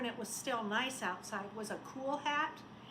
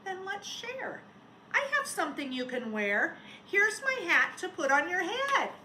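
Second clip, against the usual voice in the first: lightly voiced little girl, higher; 6 semitones higher, 6 dB louder than usual. A narrator's reading. Little girl offering her hat to the snowman. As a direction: offer it kindly like a small girl. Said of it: "A little girl said".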